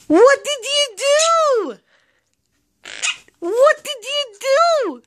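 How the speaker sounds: high, slow teasing "what did you do"; mock scolding. Owner teasing her bird over a broken dish, high mock scold. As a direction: pretend to be shocked at the pet.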